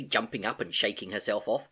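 usual speaking voice

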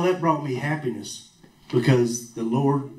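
ordinary talk, nothing marked

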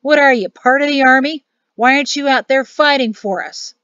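put-on loud, indignant old man; sputter, then challenge him hotly; flustered bluster rising into accusation. A narrator's reading. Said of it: "Danny hemmed and guffawed and harumphed".

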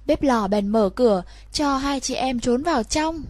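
plain reading voice

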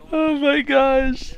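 high, slow, tearful with laughter; real laughing fit. Real, not acted. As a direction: drag it out, wrung out from laughing.

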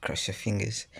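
ordinary talk

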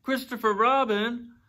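high, loud questioning call. A narrator's reading. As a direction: call the name down from a height. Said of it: "he called down again".

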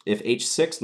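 normal talking voice

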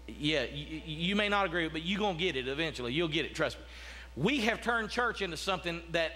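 usual speaking voice

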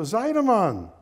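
loud, high call with a falling end. Acted. Man calling his old friend from a train window, calling out. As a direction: shout the name out to a friend. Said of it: "Yuska called out the window".

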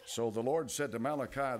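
ordinary talk, nothing marked

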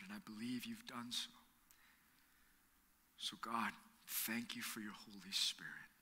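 soft, hushed prayer voice; pastor's gentle closing prayer. Real, not acted. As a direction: pray quietly over the congregation.

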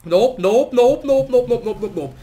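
loud, rapid chant of nopes; repeated refusal. Real, not acted. Refusing the trade again and again, loud and rapid. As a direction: hammer the nopes out.

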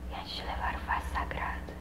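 quick, voiceless whisper; grieving whisper. Acted. Mother mourning rites she will miss, hushed whisper. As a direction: whisper it quickly under the breath.

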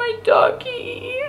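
high, slow whiny fake sob; mock wail. Gamer grieving a second virtual dog, mock wail. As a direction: stretch it out like a pouting cry. Mourning her dog again.

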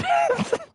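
high squealing laugh; startled shriek of laughter. Real, not acted.

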